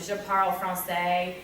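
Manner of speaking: slow French with a heavy English accent. Shown as a demonstration. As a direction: say the French with plain English sounds. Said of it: "I will use an English accent".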